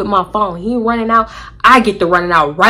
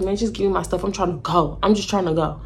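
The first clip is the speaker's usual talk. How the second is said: softer, lower man's voice, imitated; retold plea; 3 semitones lower, 6 dB quieter than usual. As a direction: quote him low and offhand. Retold plea, man asking for stuff.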